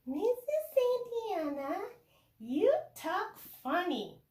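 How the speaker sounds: high, slow little pupil voice; kindergarten student. Retold or imitated. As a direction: imitate a small student, drawn out and high. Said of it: "she tapped my knee and said".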